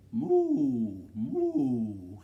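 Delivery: loud, long-drawn moo; cow mooing. A narrator's reading. Reader to children as the cow, loud mooing. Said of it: "said the cow".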